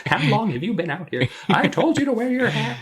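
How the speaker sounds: high-pitched imitation of a fussing wife; scolding wife. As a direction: nag him in a put-on high voice. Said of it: "the wife, too, is like".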